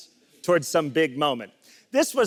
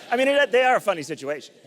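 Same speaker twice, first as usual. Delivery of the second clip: ordinary talk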